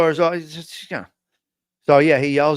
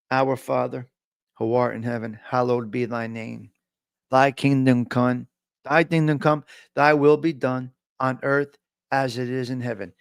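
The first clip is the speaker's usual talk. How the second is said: soft, lower, slowed reverent praying; prayer recitation; 6 semitones lower, 4 dB quieter than usual. Acted. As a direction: pray it quietly like in chapel. Recites Our Father and Hail Mary.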